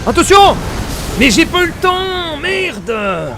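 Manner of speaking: loud, high battle shouts; panicked shout. Real, not acted. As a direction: yell through the boss fight. Boss fight.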